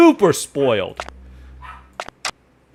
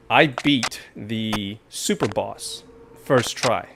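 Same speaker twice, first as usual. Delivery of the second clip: ordinary talk, nothing marked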